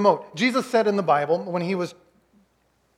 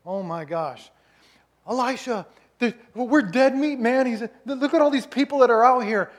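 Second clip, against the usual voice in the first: mock panic; 6 semitones higher than usual. Panicked servant sees enemy army.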